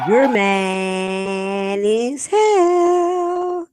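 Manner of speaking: drawn-out high exclaim, slow and loud; mad as hell. Real, not acted. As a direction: stretch it out, teasing. Reacting to meeting clip.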